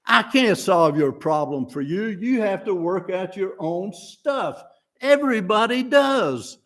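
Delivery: lightly voiced scolding Lucy; hammed-up bossy girl yelling. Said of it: "depicts Lucy yelling back at him".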